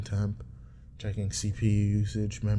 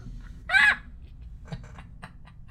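silent wheezing laugh; airy giggle; high wheeze of laughter; breathy high laugh. Real, not acted.

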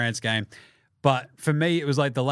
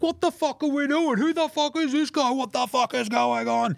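much higher, mock furious yelling; imitating angry Jim; 11 semitones higher than usual. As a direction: play Jim losing it in the stands, high and outraged. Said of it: "your old mate Jim would have been angry".

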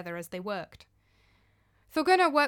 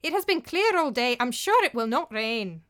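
stubborn refusal; 2 semitones higher, 6 dB louder than usual. Acted. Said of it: "replied Thorgunna".

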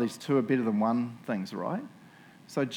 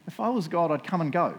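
usual speaking voice

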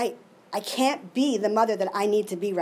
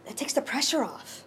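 hushed, relieved whisper; soft whisper of relief; 6 dB quieter than usual. Real, not acted. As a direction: breathe it out softly. Relief after giving up control.

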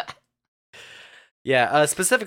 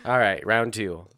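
normal talking voice

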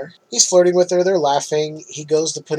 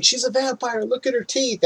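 mild mock yell; 8 semitones higher, 3 dB quieter than usual. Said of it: "And then yelling".

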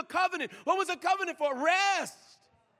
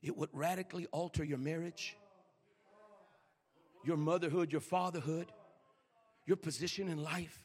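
low, soft and weighty; hushed solemn preaching; 9 semitones lower, 9 dB quieter than usual. Real, not acted. Preacher asking the congregation about hearing God, hushed and solemn. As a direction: bring it right down, low and earnest.

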